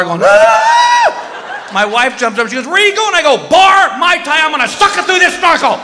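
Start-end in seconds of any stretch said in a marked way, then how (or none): 0.2-1.7 very high, sustained scream; loud shriek while fleeing. Retold or imitated. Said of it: "screaming the whole way". 2.7-3.1 high, alarmed mock shout; calling after someone. Wife shouting after fleeing husband. 3.4-5.8 high, frantic mock shout; loud, high-pitched exasperation; yelling back. Yelling back while fleeing.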